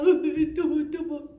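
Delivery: mock toddler fussing; whining child. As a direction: whine and nag like a small child. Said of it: "아이가 막".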